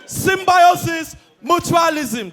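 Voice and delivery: "Symbiosis, mutualism" yelled high and slow; shouted symbiosis. Retold or imitated. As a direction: bellow "Symbiosis" and "mutualism" stretched out. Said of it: "screaming at the top of my voice".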